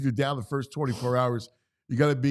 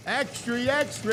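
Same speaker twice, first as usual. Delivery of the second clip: newsboy call; 12 semitones higher than usual. Acted. Newsboy call intro to segment.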